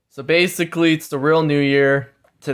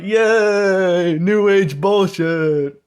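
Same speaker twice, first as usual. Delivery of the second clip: sarcastic cheer; 5 semitones higher, much slower than usual. Retold or imitated. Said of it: "you're like".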